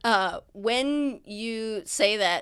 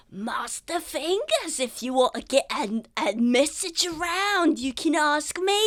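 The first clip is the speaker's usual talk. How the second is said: Gangrene; 7 semitones higher than usual. Acted. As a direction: offer help in a put-on Cockney voice. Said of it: "Cockney? I think it was Cockney".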